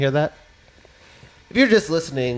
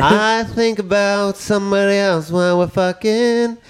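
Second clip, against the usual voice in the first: higher, slower mock lyric; slow, higher singer voice; mock singer line; 6 semitones higher, 3 dB louder, slower than usual. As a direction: croon it like a confessional singer. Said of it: "I was like".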